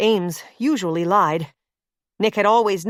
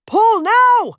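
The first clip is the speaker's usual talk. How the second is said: much higher and louder command; yelling; 13 semitones higher, 7 dB louder than usual. Acted. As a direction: bark the order with full force. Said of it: "Nick yelled".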